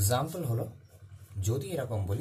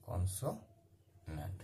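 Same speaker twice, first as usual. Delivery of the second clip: Consonant very slow and softer, split by a long pause; slow dictation; 7 semitones lower, 8 dB quieter, much slower than usual. Shown as a demonstration. Teacher writing out consonant for students, very slow and soft. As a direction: break consonant into halves, trailing down low.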